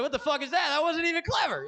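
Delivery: high, loud squeal of disbelief; outraged squeal. Retold or imitated. Comic outraged at the heckler's joke, shrieking high.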